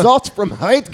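high, loud, drawn-out mock scream; Gordon Ramsay. Host imitating TV chef screaming in his sleep, mock yelling. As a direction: yell it like a kitchen tyrant. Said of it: "Gordon Ramsay has screamed at my subconscious".

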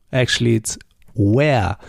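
usual speaking voice

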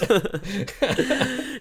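a high, helpless laugh; high burst of laughter. Real, not acted. Laughing about listeners quitting early, high laugh.

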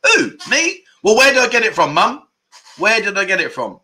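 high, loud playful comeback; jokey who-me retort; mock indignant. Real, not acted. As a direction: tease mum right back, hammed up.

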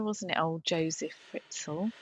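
normal talking voice

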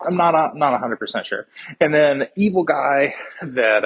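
normal talking voice

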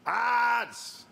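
high excited yell of a stream opener; hype shout. Real, not acted. Streamer hyping up his viewers with a shout. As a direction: shout it out to the crowd.

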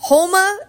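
Houma said as HOH-ma; guessed pronunciation. Shown as a demonstration. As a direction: try HOH-ma with a questioning rise. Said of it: "I probably said that so wrong".

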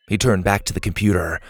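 ordinary delivery, nothing marked

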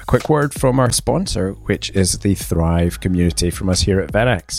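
loud, upbeat ad voice over music; sponsor read. Acted. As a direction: sell it like a radio ad. Reads a sponsor ad.

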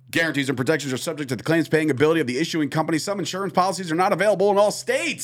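rapid legal disclaimer read; all in one breath. Shown as a demonstration. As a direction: rattle it off without a breath. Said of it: "Said that all in one breath".

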